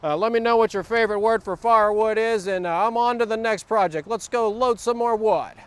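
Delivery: normal talking voice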